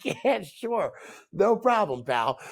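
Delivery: humoring. Retold or imitated. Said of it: "I'm like".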